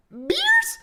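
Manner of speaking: incredulous squeak. Retold or imitated. Friend hearing beer offered before dawn, high incredulous squeak. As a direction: voice cracking way up in disbelief. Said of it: "dude, we freaking lost it".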